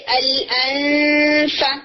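الانفا said slowly with a light touch on the فا; tajweed demo of الانفا, slow and light; ikhfa demo. Shown as a demonstration. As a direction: ease into الانفا slowly, hiding the noon. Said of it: "جب آپ فا کے ساتھ بولیں گے".

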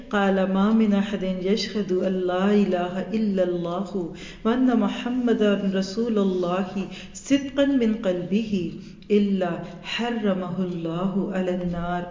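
slow, measured Arabic recitation; solemn slow chant of the Arabic text; hadith recitation. Acted. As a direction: recite the hadith reverently, word by word.

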